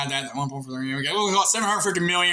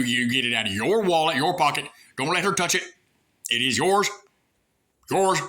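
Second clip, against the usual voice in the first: ordinary delivery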